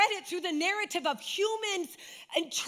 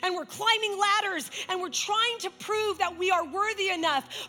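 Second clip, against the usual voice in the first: preaching at full force; 4 semitones higher than usual. Acted. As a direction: build to a fervent climax. Preaching climax over music.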